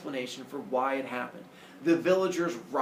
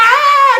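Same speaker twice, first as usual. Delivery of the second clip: piercingly high, very loud shriek; full-out villagers' scream; 20 dB louder than usual. Acted. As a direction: shriek at full volume in horror. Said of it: "Why do you keep screaming".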